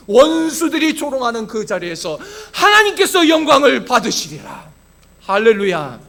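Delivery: loud, high proclamation; forceful preaching. Acted. Pastor proclaiming God's protection to the church, forceful preaching. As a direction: declare God's promise with full pulpit force.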